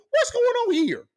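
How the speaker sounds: outraged disbelief. Real, not acted. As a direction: cry out at the injustice. Outrage over expelled students.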